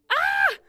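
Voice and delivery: very high, loud scream; short shriek of fright. Acted. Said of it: "Soleil screamed".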